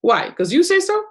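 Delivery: high, incredulous bratty retort; defiant unconscious mind. Acted. Unconscious mind talking back to the hypnotist, indignant and high. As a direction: challenge it, pitch jumping up at the end. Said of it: "your unconscious mind is gonna go".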